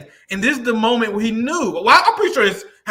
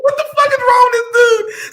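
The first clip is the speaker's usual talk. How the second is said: high, cracking laugh through the words; can't believe this guy, laughing; incredulous laughing outburst; laughing in disbelief; 13 semitones higher, 4 dB louder than usual. Real, not acted. Host cracking up at clip.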